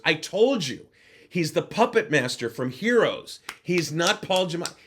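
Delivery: high and loud, triumphant; excited insistence. Retold or imitated. Businessman correcting his colleagues, loud excited I told you. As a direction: crow it to the group, told you so.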